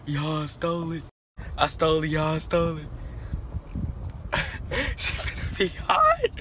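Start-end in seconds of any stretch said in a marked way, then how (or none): 2.9-4.9 breathy high giggle; giddy laugh. Real, not acted. Vlogger giggling to viewers after the prank, high breathy laugh. 5.0-6.3 much higher, squealed through laughter; laughing squeal. Real, not acted. Vlogger squealing with laughter about his cousin's anger.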